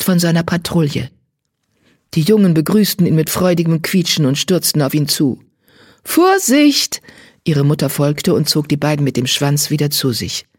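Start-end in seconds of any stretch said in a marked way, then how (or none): 6.0-7.0 high, loud cry of alarm; sharp warning cry. Acted. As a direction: snap a mother's warning at the kits. Mutter warnt die stürmenden Jungen. (hoher, lauter Warnruf der Mutter)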